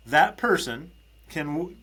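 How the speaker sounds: ordinary delivery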